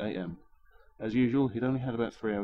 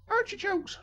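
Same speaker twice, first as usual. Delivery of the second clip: put-on much higher old woman's cry, falling at the end; old lady screaming; slower than usual. Old lady rummaging in her carrier bag, much higher scream. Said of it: "she screamed".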